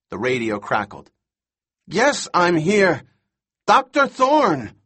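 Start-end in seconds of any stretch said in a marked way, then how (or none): 1.9-4.8 high, drawn-out loud cries; hysterical shouting. A narrator's reading. Boy on radio answers the doctor, hysterical shout. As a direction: shout slowly and desperately into a radio. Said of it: "He sounds completely hysterical".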